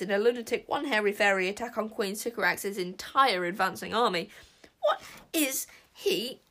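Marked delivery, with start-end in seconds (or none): 4.8-6.4 high, loud, slow disbelief; appalled gasp. A narrator's reading. Tsar watching the sprite attack, aghast. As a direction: gasp it out word by word. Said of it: "gasped Tsar".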